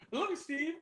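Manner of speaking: high, soft squeaky brother; a lightly voiced ad brother. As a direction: coo it like the ad. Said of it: "Tim and Eric".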